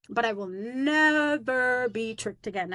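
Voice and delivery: "never" drawn out, each word slowed and set apart; slow firm vow. Retold or imitated. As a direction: say it slowly, leaning on "never". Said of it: "That is not me being aggressive".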